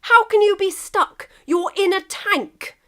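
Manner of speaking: high, loud disbelieving call; can't believe it; incredulous England. Acted. England shouting at Russia in disbelief, incredulous. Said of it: "England's reply".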